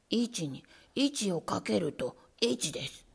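low, slow, whispered lesson; lightly voiced nervous rat; rat teacher. A narrator's reading. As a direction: recite the sum carefully.

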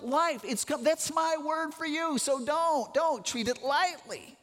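high, pressing sermon voice, pleading with the crowd; impassioned preaching. Acted. Preacher voicing Jesus' warning.

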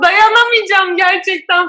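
high, loud, slow crying plea; tearful shout. Retold or imitated. Still crying out about the caller, slow high wail. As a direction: drag it out, close to sobbing. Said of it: "dayanamadım ve ağlamaya başladım".

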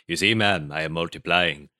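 imitating a Barbadian accent, measured; Barbadian teacher. Teacher to the whole class about his imitator, Barbadian accent impression. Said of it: "I did an impression of him".

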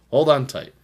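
loud high hype call; hype announcer. Acted. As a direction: shout it like a promo. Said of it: "I'm gonna say that a lot now".

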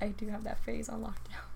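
hushed aside; whisper. Real, not acted. Confiding to a friend, whispered aside. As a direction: whisper it like a secret.

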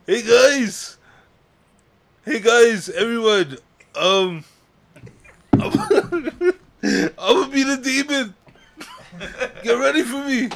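very high, loud bad Irish accent breaking into laughs; attempted Irish accent. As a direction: ham up a failed Irish accent, giggling. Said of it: "Do it again, let me hear it".

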